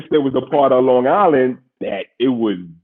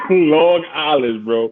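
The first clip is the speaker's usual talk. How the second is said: laughing punchline; 4 semitones higher, 4 dB louder, much slower than usual. Real, not acted. Laughing punchline about his drink.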